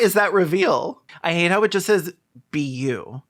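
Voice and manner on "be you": ordinary talk, nothing marked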